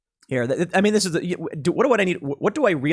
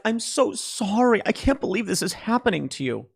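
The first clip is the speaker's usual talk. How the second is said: mock sympathy; 5 semitones higher, 3 dB quieter than usual. Boss mocking coddling of crying staff, overdone pity. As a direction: lay the fake sorrow on thick.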